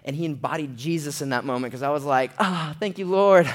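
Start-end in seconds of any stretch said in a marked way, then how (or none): 2.4-3.5 loud, high mock relief; relieved exclamation. Saved by his buddy, loud exclaimed relief. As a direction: gush thanks, raised and loud. Retold relief at being rescued.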